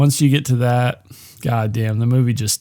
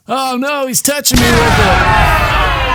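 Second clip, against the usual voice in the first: high-pitched hammed-up terror with screams; pretend attack panic; 10 semitones higher, 4 dB louder than usual. Caller faking an attack to his date, hammed-up panic. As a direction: fake being attacked, shrieking up high. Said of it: "starts pretending like he's getting attacked".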